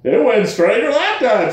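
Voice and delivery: ordinary delivery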